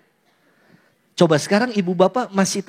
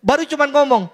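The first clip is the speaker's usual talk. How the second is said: ordinary delivery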